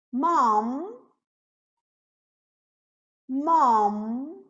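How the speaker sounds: "Mom" ending on a long hummed M; closed final M. Shown as a demonstration. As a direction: close the lips and hold the M of "Mom". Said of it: "M final, som fechado". ("Mom" com M final fechado)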